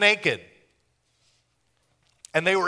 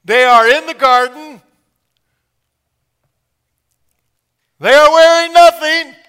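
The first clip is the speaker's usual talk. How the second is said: much higher and louder, building; preacher shouts the garden punchline at the crowd; rising excited shout; 11 semitones higher, 17 dB louder than usual. Real, not acted.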